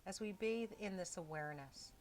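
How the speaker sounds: soft, unhurried meditation voice with long pauses; hushed body-scan guidance, leaving space between phrases; guided meditation. Real, not acted. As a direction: gently guide them into stillness, phrase by phrase.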